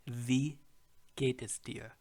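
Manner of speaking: Wie said with a V, vee. Shown as a demonstration. Teacher demonstrates the V in Wie, clear. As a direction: stress the V in Wie. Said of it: "The W in German is pronounced as a V".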